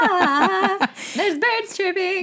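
very high, slow princess voice; dreamy Disney princess morning; cartoon princess. Shown as a demonstration. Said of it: "I picture Kristen waking up".

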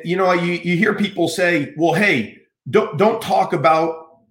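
ordinary delivery

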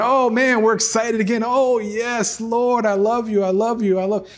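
high, giddy churchgoer gushing; sing-song mock enthusiasm of a fair-weather believer; mock excited believer. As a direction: ham up a fickle fan's excitement. Mocking fickle excited believer again.

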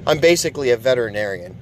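put-on reading his smug text to the girlfriend. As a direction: gloat like an expert. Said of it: "is what I told her".

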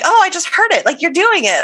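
high, bright-eyed sudden realization; excited realization. Retold or imitated. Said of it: "And I'm like".

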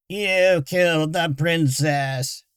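slow, cracking creature voice; broken voice. Acted. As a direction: rasp it out like a broken machine. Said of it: "said a broken voice".

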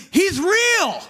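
very high, loud cry of discovery; imitated dad, thrilled and yelling; amazed shout. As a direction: shout it like an astounded convert. Dad's amazed discovery, acted out.

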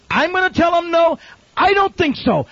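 very high, loud rhetorical outburst; incredulous shout. Real, not acted. Preacher refusing to turn missionaries away, shouting incredulously. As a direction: cry out in disbelief at the very idea.

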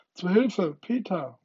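high, loud, panicked cry; desperate cry for help. A narrator's reading. Wendy falling and crying for Peter, desperate. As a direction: scream for Peter while falling. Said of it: "rief sie in ihrer größten Verzweiflung".

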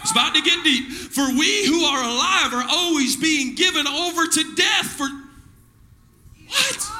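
rhythmic, chanted scripture preaching. Acted. Pastor preaching Corinthians to his church, rhythmic. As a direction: drive the verses home to the church.

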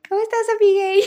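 high, sugary fake-friendly greeting; put-on sweet voice. Gamer greeting her in a put-on sweet voice. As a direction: chirp it in a put-on girly voice.

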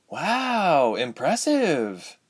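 put-on high, slow young woman's voice; girl's voice. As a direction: voice Ilse high and wary. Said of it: "Ilse said".

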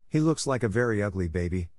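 ordinary delivery

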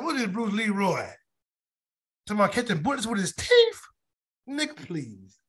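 lightly voiced movie-villain taunt; Sho'nuff. Preacher quoting The Last Dragon for laughs, lightly imitated taunt. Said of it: "that was sure enough said in the movie".

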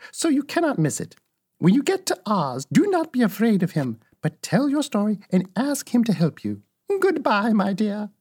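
high-pitched good witch voice; guiding old witch. Acted. Old witch sending Dorothy off, high guiding witch voice.

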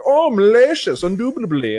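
high, loud mock-English exclamation; proper English accent. Friends drunkenly relishing a new nickname, mock-proper English accent. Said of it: "saying it in a very, like, proper, almost English language".

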